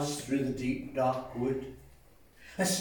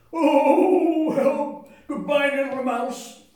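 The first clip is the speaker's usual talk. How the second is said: louder, a bit higher cry; frightened fox; 8 semitones higher, 10 dB louder, slower than usual. Acted. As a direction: yelp and bolt.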